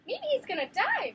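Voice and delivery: a blackbird guessing aloud, chirpy high voice. A narrator's reading. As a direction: chirp it like a little bird. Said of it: "chirped a blackbird".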